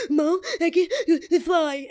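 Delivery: high, slow, gulping fake cry; mock sobbing. Teen on the phone to mom, hammed-up sobbing. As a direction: sob it out, hammed-up. Acting tearful call to mom.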